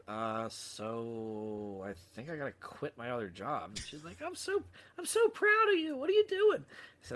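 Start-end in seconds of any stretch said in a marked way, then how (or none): 0.1-3.7 retold sheepish confession, dragged out; hesitant admission. Breaking news to wife, drawn out. 4.2-6.6 very high, gushing imitation of a wife; hammed-up high voice of a proud spouse; delighted wife. Acting out his delighted wife.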